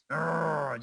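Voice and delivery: drawn-out growl from the back of the throat; throaty growl. Shown as a demonstration. Showing the audience how to get the trailer voice, throaty growl. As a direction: growl it out as a demonstration. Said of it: "And then you just kinda growl".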